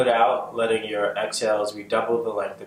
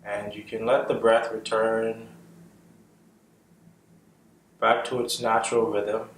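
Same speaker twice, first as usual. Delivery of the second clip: guided meditation; 3 dB quieter than usual. Acted. Guiding listeners' awareness up from the feet, calm meditation voice. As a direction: lead them gently through the body, unhurried. Leads a body scan meditation.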